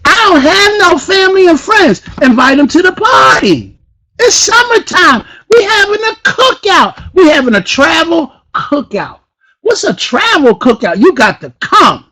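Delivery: high-pitched, loud excitement; hyped up. Real, not acted. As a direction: sell it like the party of the year. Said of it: "Am I a little bit too hyped".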